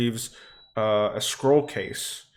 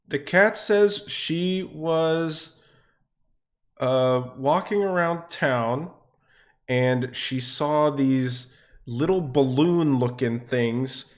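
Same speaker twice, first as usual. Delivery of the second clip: slow, deliberate character voice, slightly raised; Xena translating; 4 semitones higher, 4 dB louder, slower than usual. Acted. Party member translating the cat to them all, deliberate. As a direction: relay it slowly, word by word. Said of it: "Xena will turn to you all and she will say".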